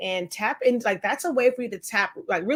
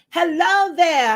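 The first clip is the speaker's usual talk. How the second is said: grand greeting; 7 semitones higher, 7 dB louder, slower than usual. Acted.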